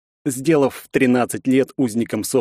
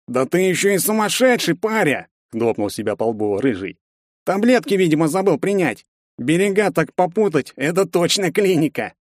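mocking thug; 7 semitones higher than usual. Redhead mocks hero.

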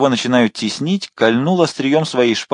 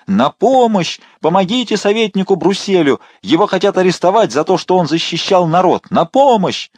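much higher and louder wailing; screaming for help; 9 semitones higher, 4 dB louder than usual. A narrator's reading. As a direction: scream even harder out the window. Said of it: "принялась вопить ещё сильнее". (вопит ещё сильнее)

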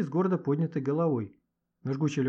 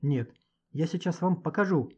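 ordinary delivery

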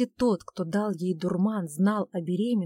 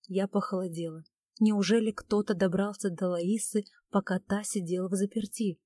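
usual speaking voice

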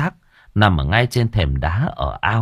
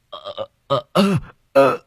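terrified stammer; 6 semitones higher than usual. Acted. Chief touched by corpse hand.

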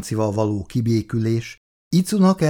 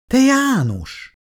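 high, pleading cry of a name; begging his old friend to stop; almost imploring; 4 dB louder, slower than usual. A narrator's reading. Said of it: "szinte könyörgőn".

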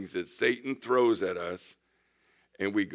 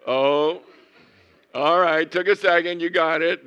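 loud playful jab; 7 semitones higher, 10 dB louder than usual. Real, not acted. Preacher teases congregation for getting the joke late, loud and playful. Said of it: "congregation's late reaction".

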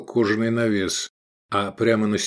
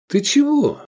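very high, loud query; astonished; 5 dB louder than usual. A narrator's reading. Diner baffled by cook's anger, astonished. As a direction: squeak it in amazement. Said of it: "изумился Эдик".

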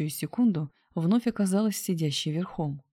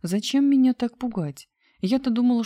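higher, louder mild scolding; lightly acted indignation after a false scare; indignant reproach; 7 semitones higher, 5 dB louder than usual. A narrator's reading. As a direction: scold a lover for the fright, voice lifted. Scared woman scolds her teasing lover.

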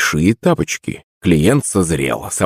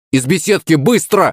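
high, urgent warning; raised, high-pitched command; urgent shout; 8 semitones higher than usual. A narrator's reading. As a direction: cry out to get everyone moving. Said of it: "крикнул я".

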